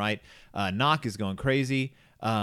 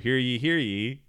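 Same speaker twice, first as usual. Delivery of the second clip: town crier. Retold or imitated. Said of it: "there's a town crier comes out".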